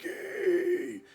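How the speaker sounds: very high, desperate cry of the name; trapped grandpa calling out for help; Grandpa crying Yugi. Retold or imitated. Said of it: "He's like".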